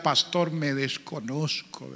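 soft, slow broken plea; understated tearful confession; mild retold weeping. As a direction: quietly voice a weeping man. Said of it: "él lloraba y decía".

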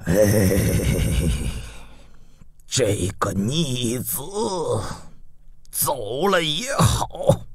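slow, low, soft sigh of grief; weary old ruler mourning; grieving father. A narrator's reading. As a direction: heave a sigh and resign yourself. Grieving father after son's death.

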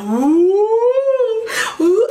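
high, loud trumpeting labor moan; elephant-like labor cry. Retold or imitated. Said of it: "I'm sounding like an elephant".

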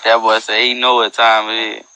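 usual speaking voice

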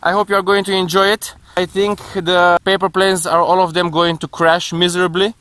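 usual speaking voice

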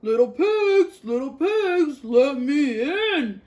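loud, slow menacing wolf call; wolf calling at the straw house, loud and slow; big bad wolf. A narrator's reading. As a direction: boom it out like a hungry wolf. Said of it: "he called".

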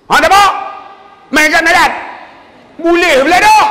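very high, loud official; brash, over-the-top yelling; clerk's shouted reply. Retold or imitated. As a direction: holler each phrase across the counter. Clerk's shouted reply in retold scene.

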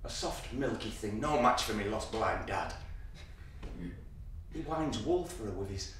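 hushed. Acted. Boy's hushed inner monologue.

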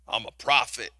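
low, self-important declaration; mock prophet. Preacher mocking self-styled prophets, low pompous boast. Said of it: "You're not called to be an Old Testament prophet".

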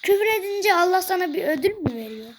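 ordinary delivery

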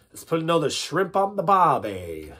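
hammed-up Aussie, stretching "barbie"; Australian accent. Shown as a demonstration. Leading accent warm-ups, broad Australian accent. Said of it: "let's do accent warm-ups".

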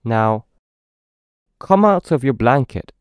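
ordinary delivery, nothing marked

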